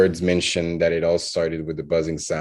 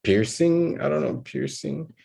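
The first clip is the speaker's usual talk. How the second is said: much higher, questioning the word; high-pitched doubt; 8 semitones higher than usual. Real, not acted. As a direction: pipe up high, trying the word out.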